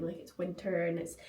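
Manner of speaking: usual speaking voice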